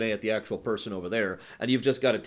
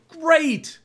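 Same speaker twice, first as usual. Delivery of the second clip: much higher, loud cheer; excited approval; 10 dB louder than usual. Real, not acted. Streamer cheering Sony's possible new direction, loud and thrilled. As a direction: cheer the idea.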